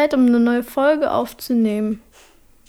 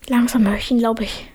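whispering; 5 semitones lower, 4 dB louder than usual. Real, not acted. Listening for him, whispering.

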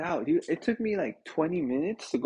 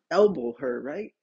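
usual speaking voice

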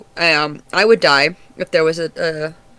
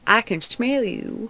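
higher, slow and slurred creature voice; creepy creature voice; 6 semitones higher, 3 dB quieter, slower than usual. Acted. Sewer creature sniffing out its prey, creepy slow voice. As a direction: draw it out like a lurking sewer creature.

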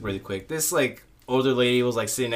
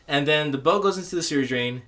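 ordinary talk

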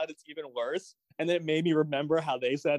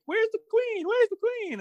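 high-pitched call; squeaky calling voice. Pretend. Mock queen calling out.

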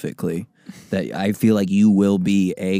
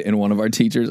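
usual speaking voice

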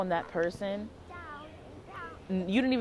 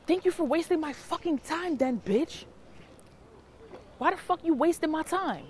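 higher-pitched, mildly heated telling-off; retold angry outburst; 8 semitones higher than usual. Telling off the woman who wasted time, retold anger. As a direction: re-enact cussing out a time-waster. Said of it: "why did I get so angry like that?".

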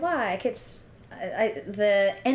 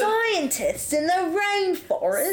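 high, slow put-on Australian accent; Australian accent attempt; 8 semitones higher, slower than usual. Shown as a demonstration. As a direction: stretch it out in mock Australian. Demonstrating Australian accent, in turn.

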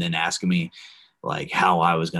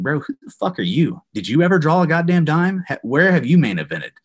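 mock confrontation; 7 semitones higher, 4 dB louder than usual. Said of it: "I just wanted to be like".

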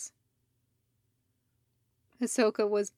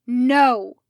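shouted no; 11 dB louder than usual. Acted. Fives erupting in argument.

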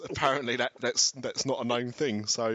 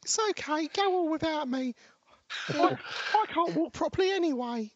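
put-on very high little boy voice; hammed-up sickly child, pitched way up; Tiny Tim. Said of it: "Leave, leave Tiny Tim there by the gate".

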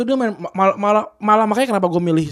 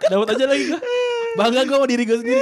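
higher, laughing proudly; 9 semitones higher, faster than usual. Real, not acted. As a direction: crack up at your own joke. Laughing after own joke.

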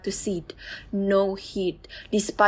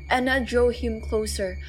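plain reading voice

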